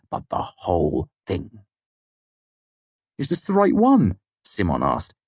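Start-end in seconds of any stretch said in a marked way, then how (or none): 3.2-4.2 high, louder-edged incredulous question; excited disbelief. A narrator's reading. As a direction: ask it thrilled and barely believing. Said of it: "a mixture of excitement and disbelief".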